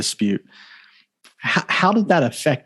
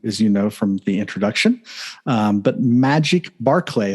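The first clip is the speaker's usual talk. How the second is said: ordinary delivery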